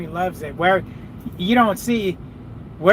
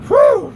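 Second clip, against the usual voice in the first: high, loud relieved exhale; whew of relief; 8 dB louder than usual. Real, not acted. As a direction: blow out the tension. Relief after a confusing moment.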